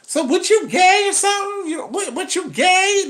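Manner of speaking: shrill-pitched mock of an offended woman, loud; high, loud, indignant woman caricature. Mocking angry women's reaction.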